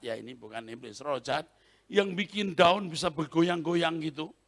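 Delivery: usual speaking voice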